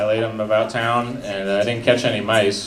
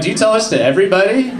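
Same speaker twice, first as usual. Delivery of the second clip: exasperated retort; 9 semitones higher, 3 dB louder, faster than usual. Retold or imitated. Exasperated reply to sister.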